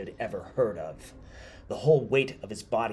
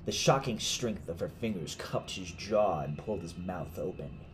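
plain reading voice

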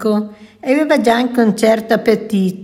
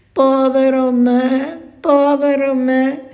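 higher, slow, plaintive moan of self-pity; lamenting; 4 semitones higher than usual. A narrator's reading. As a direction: wail it like a sorry little dog. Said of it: "cominciò a lamentarsi".

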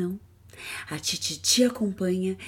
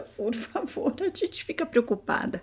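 high, pleading worried voice; 10 semitones higher than usual. Acted. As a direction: beg them to be careful, up high.

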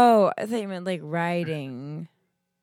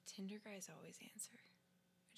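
fast, hushed whisper; 29 dB quieter than usual. Real, not acted. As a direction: whisper it so the callee won't hear. Waiting for the call to connect.

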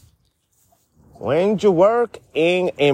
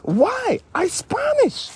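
high, indignant squeak; high squeaky protest; much slower than usual. Acted. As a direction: squeak it out, feigning hurt. Mock offended reply.

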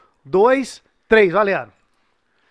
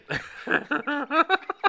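surprised laugh; 6 semitones higher, 5 dB quieter than usual. Real, not acted. Laughs at porn question.

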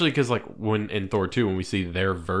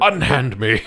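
hammy stage actor; 3 semitones higher, 5 dB louder, slower than usual. Retold or imitated. Quoting Thor's hospital line.

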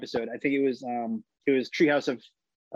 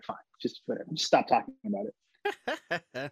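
fed-up parent; 3 semitones higher, 4 dB quieter than usual. Retold or imitated. Parents giving in, exasperated.

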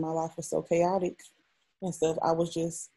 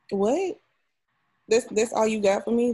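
high, louder outraged what; can't believe that's all he offers; disbelief; 4 semitones higher, 4 dB louder than usual. Retold or imitated. To the doctor who only gave pills, high and louder disbelief.

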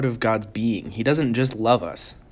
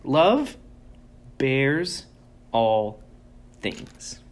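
each word set apart by pauses; slow weighty emphasis, word by word; drawn-out emphasis; 3 semitones higher, much slower than usual. Acted. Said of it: "this is huge".

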